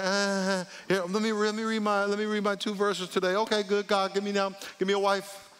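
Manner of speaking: high, monotone put-on voice; perfunctory believer's mock prayer. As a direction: mimic someone rushing through token devotions. Mimics a perfunctory believer praying.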